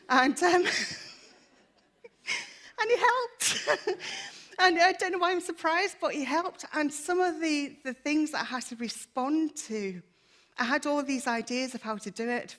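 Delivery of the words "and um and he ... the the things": high, loud tearful laugh breaking into the words; real emotion, laughing and crying at once; laughing through tears. Real, not acted. Tearful thanks, God helped.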